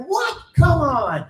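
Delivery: high, loud What then Come on; a disbelieving, pleading retort; mock outrage at the bank's rule; incredulous protest. Retold protest to banker.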